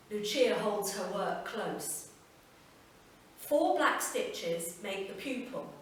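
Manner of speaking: plain reading voice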